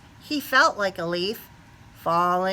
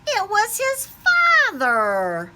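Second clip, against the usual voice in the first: surprise reveal; 12 semitones higher, 5 dB louder, slower than usual. A narrator's reading. Reveal twist in the story.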